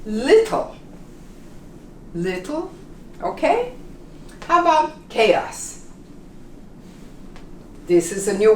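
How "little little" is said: Little with a flapped t, second take softer; little demo. Shown as a demonstration. Teacher repeats Little for learners, easy. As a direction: say Little twice, then quieter. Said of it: "It really becomes simple".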